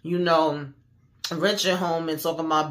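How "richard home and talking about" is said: ordinary delivery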